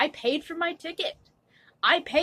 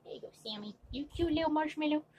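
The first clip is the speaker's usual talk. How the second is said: cooing to pet; 4 semitones higher, 14 dB quieter, faster than usual. Real, not acted. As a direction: murmur sweetly to a little pet. Cooing to Sammy, likely a pet.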